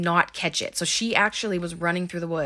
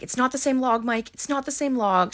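high-pitched mock insistence; raised-pitch, emphatic retelling; retold insistent protest; 7 semitones higher than usual. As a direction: imitate someone frantically repeating a denial. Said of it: "saying it over and over again".

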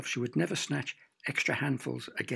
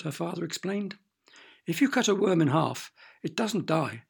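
plain reading voice

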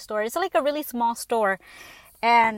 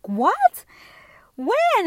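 rising, louder disbelief; shocked; 9 semitones higher, 5 dB louder than usual. Retold or imitated. Hearing her daughter's news, a shocked higher cry. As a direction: shoot the question up high in shock. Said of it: "I was like".

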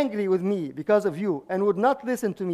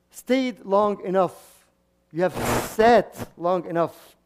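slow emphasis; slower than usual. Shown as a demonstration.

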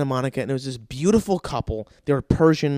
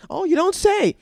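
very high-pitched mock amazement; sarcastic. Patient answering front desk sales pitch, sarcastic. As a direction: feign wonder in a piping, squeaky pitch.

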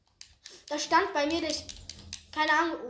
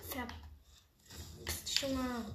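whispering; 6 dB quieter, much slower than usual. Real, not acted. Muttered insult at player.

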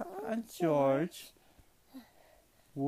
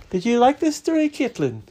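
bright question; 7 semitones higher, 8 dB louder than usual. Real, not acted. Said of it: "dad asks daughter after story".

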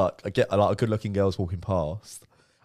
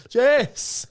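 high loud shout across the pool; shouting a name; 8 dB louder than usual. Retold or imitated. Shouting after a girl who won't turn round, loudest try. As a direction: yell her name as loud as you can. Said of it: "I was like".

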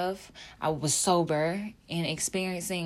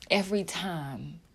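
ordinary delivery

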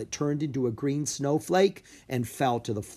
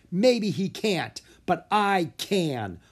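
raised, slow, confident; defiant call; 7 semitones higher, 3 dB louder, slower than usual. A narrator's reading. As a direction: answer the giant boldly. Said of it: "a voice yelled".

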